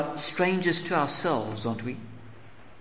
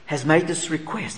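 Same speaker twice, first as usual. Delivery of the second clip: ordinary talk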